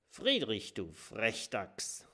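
stern. A narrator's reading. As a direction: scold the cheeky child gently but firmly. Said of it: "sagt Willi streng". (streng und leise, langsam tadelnd)

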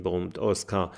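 usual speaking voice

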